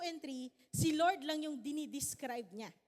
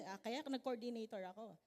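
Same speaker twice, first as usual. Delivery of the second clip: ordinary talk, nothing marked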